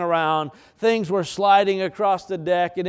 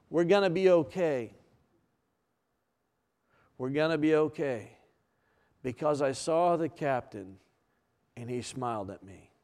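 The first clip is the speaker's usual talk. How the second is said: reassuring; 4 semitones lower, 8 dB quieter than usual. Retold or imitated. Passenger reassures frightened folks below.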